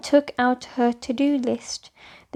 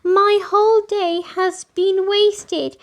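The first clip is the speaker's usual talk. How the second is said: put-on upset child; 8 semitones higher, 4 dB louder than usual. A narrator's reading. Girl complains of wasted day.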